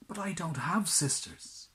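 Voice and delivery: puzzled prince. Acted. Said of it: "said the prince".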